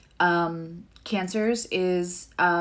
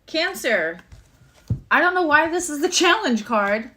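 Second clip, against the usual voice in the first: usual speaking voice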